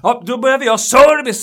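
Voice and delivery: loud, high sarcastic jab; sarcastic customer. Retold or imitated. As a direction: sneer it out like a fed-up customer. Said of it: "sa han".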